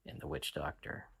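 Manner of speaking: usual speaking voice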